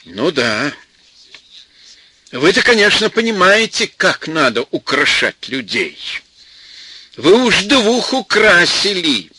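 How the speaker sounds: sarcastic and seething, slowing at the end; mock-polite, boiling over with resentment; indignant. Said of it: "Веню трясло от негодования".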